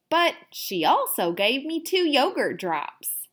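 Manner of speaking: usual speaking voice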